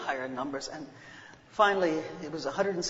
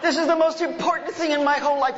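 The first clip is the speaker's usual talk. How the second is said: put-on high, loud, pleading kid; exasperated child; 9 semitones higher, 4 dB louder than usual. Embarrassed child shouts at his singing father, high and loud. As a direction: beg him to stop, mortified.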